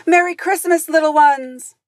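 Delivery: put-on high, loud call to the children; loud, high-pitched holiday holler; cheery shout. A narrator's reading. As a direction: shout a merry greeting out loud. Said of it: "he shouted".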